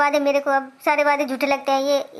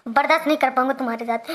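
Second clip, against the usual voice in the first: normal talking voice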